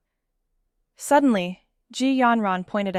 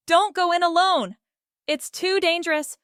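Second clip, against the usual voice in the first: shouting; 7 semitones higher than usual. Acted. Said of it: "Zhao shouted".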